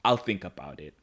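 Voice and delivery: low, hushed, tender father. Retold or imitated. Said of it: "he's like".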